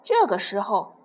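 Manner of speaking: plain reading voice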